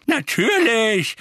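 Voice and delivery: loud, high, drawn-out retort; exasperated emphatic answer; impatient. A narrator's reading. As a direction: snap it as if it were obvious. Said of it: "sagte Misses Figg ungeduldig".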